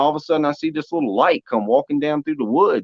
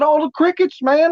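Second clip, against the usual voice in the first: awed exclaim. Retold or imitated. In awe of the sound.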